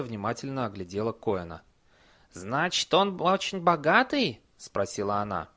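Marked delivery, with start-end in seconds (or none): 2.3-4.4 high, slow greedy question; troll wife. Acted. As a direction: ask it greedily. Wife asks.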